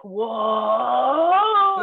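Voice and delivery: long swelling wow, rising in pitch; mock amazement. Imitating people seeing you dressed up for an interview, amazed. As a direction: draw it out like awestruck onlookers. Imitates impressed onlookers at glow-up.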